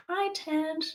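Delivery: high, soft cutesy pet voice; cat voice. Pretend. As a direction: coo hello to the cat. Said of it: "annoying cat voice".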